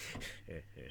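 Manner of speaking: low, quiet sinister chuckle; Jack Nicholson. Acted. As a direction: imitate a soft villainous heh-heh-heh. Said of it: "you had the Jack Nicholson".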